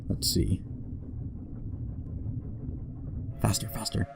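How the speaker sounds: whispered urging to the screen. Real, not acted. Whispering at the game to hurry. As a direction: breathe it out, no voice. Gamer urges the train on.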